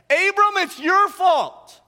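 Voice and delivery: high, loud accusing yell; erupting wife. Acted. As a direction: yell the blame at your husband, drawn out. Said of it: "and she erupts".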